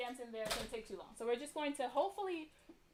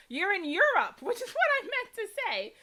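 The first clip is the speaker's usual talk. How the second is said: laughing correction; 8 semitones higher, 5 dB louder than usual. Real, not acted. Laughing at her own geography slip.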